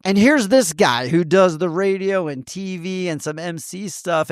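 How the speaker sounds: ordinary talk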